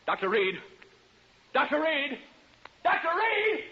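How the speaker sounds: loud high shout across the house; calling out. Acted. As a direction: call him from far away. Calling colleague again, building.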